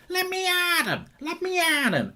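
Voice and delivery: very high, squeaky cartoon puppy; pinched, sky-high cartoon battle cry; Scrappy-Doo. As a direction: squeal it like a feisty little cartoon dog. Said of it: "England were Scrappy-Doo".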